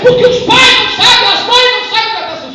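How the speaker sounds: loud, rising fervent sermon; full-force preaching. Acted. Pastor urging the church about future generations, full-force preaching. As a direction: preach it out, building to a shout.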